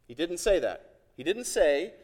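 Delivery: ordinary talk, nothing marked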